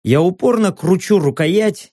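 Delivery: plain reading voice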